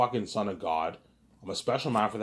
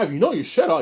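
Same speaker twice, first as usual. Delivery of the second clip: high, loud mock friend voice; voicing Sam's teasing up high; imitating Sam; 8 semitones higher, 10 dB louder, faster than usual. Ribbing friend Ivan about knowing his stuff, in a high loud voice.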